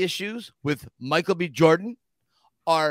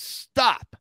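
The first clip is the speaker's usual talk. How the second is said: mock shout to stop filming; imitating a producer yelling stop; retold scream; 5 semitones higher, 4 dB louder, slower than usual. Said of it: "comes running down and screams".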